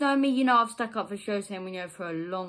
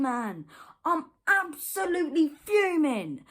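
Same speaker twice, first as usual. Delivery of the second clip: raised, high-pitched furious rant; angry rant; 7 semitones higher than usual. Real, not acted. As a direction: let the anger boil over.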